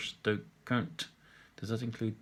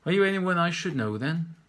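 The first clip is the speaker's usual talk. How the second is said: put-on a touch higher, prying old chap; nosy old man, louder; 7 semitones higher, 7 dB louder than usual. Old man asking the artist if he's famous, higher and louder. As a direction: lean in and pry like a curious old local.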